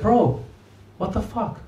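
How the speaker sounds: ordinary delivery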